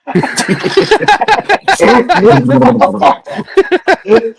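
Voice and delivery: long, high burst of laughter; helpless laughter. Real, not acted. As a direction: crack up and keep laughing. Friends cracking up over the joke.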